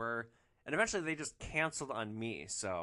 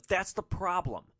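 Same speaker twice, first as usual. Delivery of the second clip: loud, slow and cutting; louder, slower angry reply; angry retort; 7 semitones higher, 7 dB louder than usual. Retold or imitated. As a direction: lay the blame flatly on him. Angry woman in quarrel.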